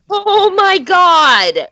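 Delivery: laughing, slow, loud "oh my God"; half laugh, half disbelief; amazed laughing exclamation. Real, not acted. Laughing reaction to big spot.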